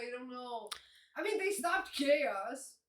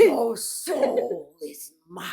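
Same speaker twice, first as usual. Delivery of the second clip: demonstrated villain voice; 2 semitones lower, 14 dB louder, much slower than usual. Shown as a demonstration. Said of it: "You gotta go like".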